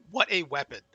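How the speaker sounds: usual speaking voice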